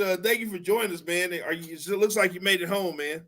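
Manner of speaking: usual speaking voice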